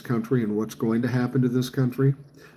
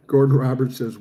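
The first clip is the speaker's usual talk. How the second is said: ordinary talk, nothing marked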